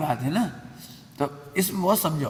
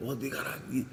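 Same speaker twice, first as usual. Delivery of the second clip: softly muttered verdict on someone; dismissive judgement; 3 semitones lower, 9 dB quieter than usual. Acted. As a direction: drop the volume, mildly contemptuous. Repeats the acted contemptuous judgement.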